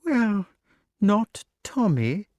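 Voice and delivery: high, slow, cool reply; cool snake. A narrator's reading. Snake corrects Wart about its name, high and cool. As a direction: put him in his place coolly. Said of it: "said the snake rather coolly".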